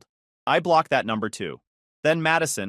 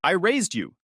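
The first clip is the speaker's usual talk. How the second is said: sharp, higher retort; snapping; 6 semitones higher than usual. Acted. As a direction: snap it back, dropping the sweet act. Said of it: "she snaps".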